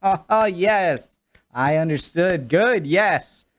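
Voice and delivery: high, forced laugh pretending to get the joke; fake laugh along. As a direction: laugh along like you understood, bluffing. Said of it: "I'd just be like".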